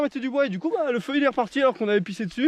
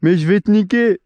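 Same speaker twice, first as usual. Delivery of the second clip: mock threat; 3 semitones lower, 8 dB louder than usual. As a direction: threaten the bee like a tough guy. Threatening a bee.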